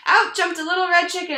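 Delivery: high, loud outburst; eager interruption; excited burst. Acted. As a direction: burst in again. Chicken bursts into the tale.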